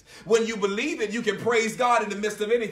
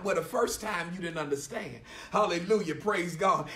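normal talking voice

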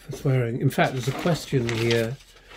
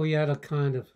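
normal talking voice

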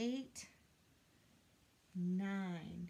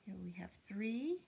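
ordinary delivery, nothing marked

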